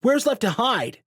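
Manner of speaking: high, loud panicked question; frantic cry. A narrator's reading. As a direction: shout it in despair. Frantic crowd protest.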